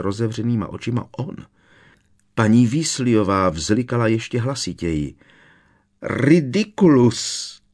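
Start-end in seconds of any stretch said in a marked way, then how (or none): 6.0-7.6 slow, moaned spell, drawn out; the spell sinks into a moan of despair. A narrator's reading. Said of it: "zasténala znovu". (sténavě, velmi pomalu)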